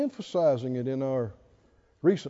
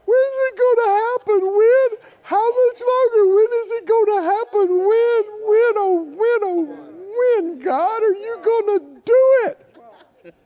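high, whiny mock-crying plea; mock whining; 6 dB louder than usual. Preacher mocking believers who whine to God, high mock-whining. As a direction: whimper it like a sniveling complainer, much higher and faster. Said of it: "sniveling, crying, Kleenex wasting questions".

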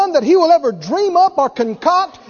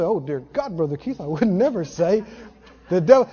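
mock pious shock; 9 semitones lower, 7 dB quieter, faster than usual. Preacher mocking timid churchgoers, hushed prim shock. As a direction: lower and much softer, prim gasp. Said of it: "People say".

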